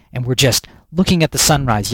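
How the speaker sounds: ordinary delivery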